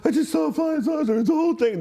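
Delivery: put-on eighteen-year-old blurting news to his sleepy parents; excited announcement. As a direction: burst in on sleeping parents, wide-eyed. Waking parents to tell them.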